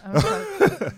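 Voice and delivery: squeaky high impressed; high mock voice. Shown as a demonstration. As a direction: repeat it in a squeaky voice.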